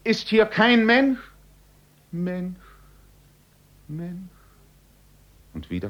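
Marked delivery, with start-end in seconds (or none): 0.0-1.2 very high, loud cry of despair; anguished cry. A narrator's reading. Man alone in the mist, crying out desperately. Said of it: "er schrie auf". 2.1-4.3 high echo dying away softer; fading echo. A narrator's reading. Echo repeating the man's cry, fading away.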